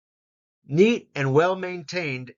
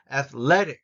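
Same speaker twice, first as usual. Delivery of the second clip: "Athletic" with "le" punched; stress on second syllable; 4 dB louder than usual. Shown as a demonstration. Teacher introducing a word to copy, stressed second syllable. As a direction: hit the "le" in "Athletic". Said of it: "le gets emphasis".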